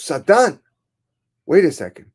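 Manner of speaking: high, loud, alarmed questioning; frightened alarm. Acted. Frightened man questions Satan about the change, alarmed. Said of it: "He was frightened".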